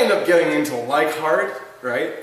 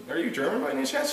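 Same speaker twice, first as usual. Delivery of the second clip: retold polite query; 11 semitones higher, 4 dB quieter, faster than usual. Retold polite question to stranger.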